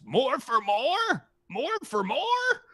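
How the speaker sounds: high, slow, questioning dragon; a greedy dragon haggling slowly; bargaining dragon voice. Acted.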